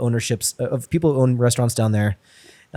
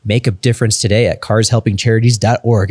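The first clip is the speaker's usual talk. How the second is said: louder, upbeat commercial voice; ad read; 2 semitones higher, 5 dB louder than usual. Acted. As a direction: sell the donation like a radio spot. Reads sponsor ad.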